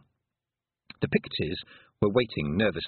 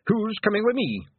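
high, loud rallying call; rallying shout; 13 semitones higher, 4 dB louder than usual. Acted. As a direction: rouse the troops. Rallying war cry.

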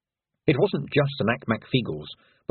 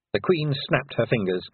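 plain reading voice

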